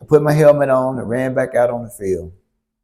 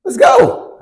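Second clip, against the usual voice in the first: high, eager yes; squeaky eager teen agreeing; 14 semitones higher, slower than usual. Retold or imitated. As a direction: jump at the offer like a kid. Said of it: "I was like".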